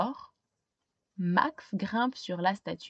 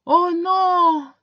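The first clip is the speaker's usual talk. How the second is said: high, louder dismayed oh no; dismayed cry; 7 semitones higher, 8 dB louder than usual. Acted. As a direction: wail at the lost hair. Max dismayed.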